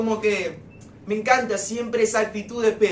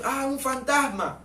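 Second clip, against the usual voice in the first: a light, higher mock gasp of fear; lightly acted scared disciple; mock fright; 5 semitones higher than usual. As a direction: toss off the disciples' fright, half-playing it. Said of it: "muchos están y dicen".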